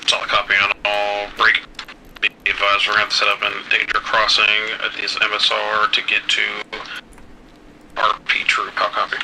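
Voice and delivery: loud, distorted radio transmission; crackly military radio. Real, not acted. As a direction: voice over a field radio. Voice coming over the radio.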